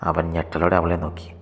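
plain reading voice

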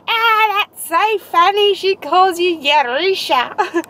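high-pitched, hammed-up Aussie accent; broad Aussie impression. Imitating Rebecca amused by the nickname, high broad Aussie voice. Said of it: "Aussie as they come".

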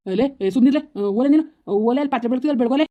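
urgent hushing. Acted. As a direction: shoo her away loudly. Husband hushes wife, orders her off. (ಜೋರಾಗಿ ಗದರಿಸುವ ಧ್ವನಿ)

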